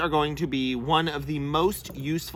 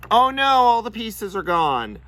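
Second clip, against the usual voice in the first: higher, louder playful "oh no"; mock dismay; 7 semitones higher, 5 dB louder than usual. Bird owner pretending to find the toy chewed up, mock dismay. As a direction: put on a pretend dismayed exclamation. Acts out finding toy destroyed.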